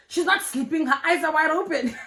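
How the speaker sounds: high, mock-outraged comeback breaking into laughter; exasperated and high-pitched, tipping into a laugh; retold indignant retort. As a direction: imitate her protest, amused at the end. Retold girlfriend's retort, teller amused.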